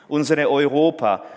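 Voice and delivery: ordinary talk, nothing marked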